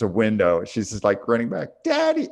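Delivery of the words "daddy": put-on high little-girl cry of Daddy, much higher; a frightened child calling for her dad, mimicked; imitating daughter's scream. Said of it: "and she's screaming".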